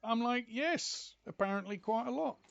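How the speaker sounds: loud, high retold argument; animated retelling. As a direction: relive the quarrel with indignation. Heated retold argument, raised voice.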